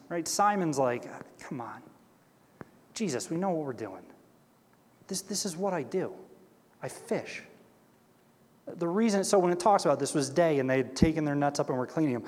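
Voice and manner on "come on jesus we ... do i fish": quiet, weary brush-off to Jesus; underplay a tired fisherman saying we know this; mild reluctant Simon. Retold or imitated. Simon pushing back on Jesus.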